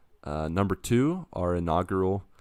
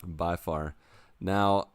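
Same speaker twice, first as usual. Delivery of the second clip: ordinary talk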